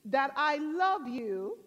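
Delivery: imitating a solemn voice from above; voice from heaven. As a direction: call down slowly, spacing the phrases. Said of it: "The voice came back and says".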